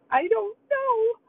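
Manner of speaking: high, slow whimpering answer; crying child. Retold or imitated. Young granddaughter answering grandma while crying, high and slow. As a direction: wail it like a tearful little girl. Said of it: "She's crying".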